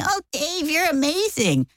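high, softened fawning praise; mock an adoring fan; exaggerated high-pitched admiration; gushing. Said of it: "alongside them saying".

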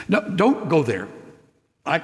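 ordinary delivery

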